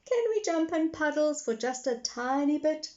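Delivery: put-on high eager little girl. A narrator's reading. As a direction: ask like an eager small girl. Peppa voiced by narrator.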